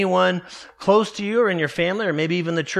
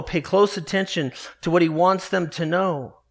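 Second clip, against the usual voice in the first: ordinary delivery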